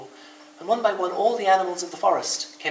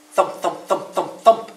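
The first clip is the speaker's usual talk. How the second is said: louder steady thumps; thumping feet; 5 semitones higher, 7 dB louder, slower than usual. Acted. Rabbit's feet keeping the beat, louder thumps. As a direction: thump it out in rhythm. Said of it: "said Rabbit's feet".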